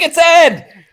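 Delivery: high, excited call of recognition; excited spotting. Retold or imitated. Tourist mistaking a stranger for [name], excited high call. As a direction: blurt it out like spotting a star.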